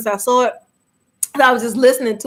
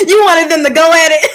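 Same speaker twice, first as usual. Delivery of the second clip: laughing tease; 5 semitones higher, 6 dB louder than usual. Real, not acted. Teasing co-host, laughing.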